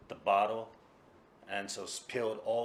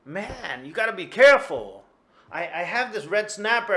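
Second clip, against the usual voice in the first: higher and louder, incredulous; can't believe the cat did it again; exasperated disbelief; 10 semitones higher, 13 dB louder than usual. Acted. Tora-san's exasperated disbelief.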